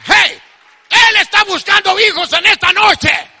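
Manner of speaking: loud, high revival shout; full-force preaching. Real, not acted. Preacher to congregation about God seeking sons, shouted preaching. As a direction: drive it out, stretching to the last word.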